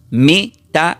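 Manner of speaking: "metanoeao" broken slowly into syllables; syllable-by-syllable pronunciation. Shown as a demonstration. Preacher shows the Greek word for repentance, "metanoeao" pronounced syllable by syllable. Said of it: "esta palabra se pronuncia como".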